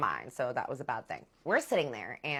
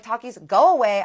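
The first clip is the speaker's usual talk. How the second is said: much louder, firm; loud dismissal; 6 semitones higher, 14 dB louder than usual. Retold or imitated. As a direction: order him off. Sending the ex away.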